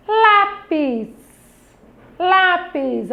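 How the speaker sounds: LÁPIS with LÁ pitched high and held; syllable stress demo. Shown as a demonstration. Teacher points children to the tonic syllable, Lápis stressed high on LÁ. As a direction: hit LÁ high in Lápis, then drop. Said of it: "ler com maior intensidade a palavra".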